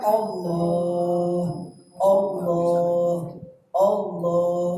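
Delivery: low, soft, drawn-out Allah; soft slow Allah. Shown as a demonstration. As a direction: breathe each Allah gently and slowly. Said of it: "Tapi Anda katakan". (ucapkan Allah pelan dan lembut)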